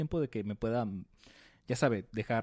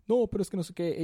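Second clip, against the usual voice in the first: whiny protest; 7 semitones higher than usual. Retold or imitated. Volfunga protesting.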